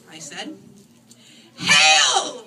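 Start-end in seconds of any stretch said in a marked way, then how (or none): 1.6-2.4 loud unison cry of tiny voices; a whole colony shouting as one; loud mock chorus greeting. A narrator's reading. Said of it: "replied the mice with one voice".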